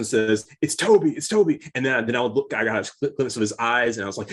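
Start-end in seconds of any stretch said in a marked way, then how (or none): 0.6-1.6 higher and louder, thrilled recognition; excited. Retold or imitated. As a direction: blurt it out, thrilled. Cousin excitedly recognizing actor.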